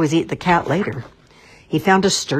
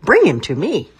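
loud, higher commanding king; king's order; 5 semitones higher, 7 dB louder than usual. A narrator's reading. King ordering his servants, loud and commanding. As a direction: order the cat brought in. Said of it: "he ordered".

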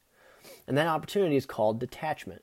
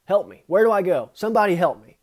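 pleading, rising to a loud call; calling out for help; 5 semitones higher, 6 dB louder than usual. Pretend. Stuck in the maze, calling to unseen watchers, loud and pleading. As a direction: shout for someone to come help. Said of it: "So you finally call out".

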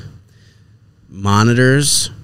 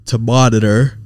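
words stretched by a yawn; 2 semitones higher than usual. Real, not acted. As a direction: talk through a yawn. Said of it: "Sorry I'm yawning so much".

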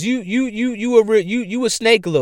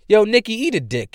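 normal talking voice

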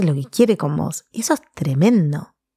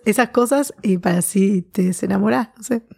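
ordinary delivery

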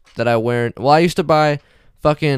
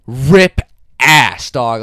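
loud, punched-out punchline; 10 dB louder, much slower than usual. Real, not acted. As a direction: belt out the punchline. Punchline of fart story.

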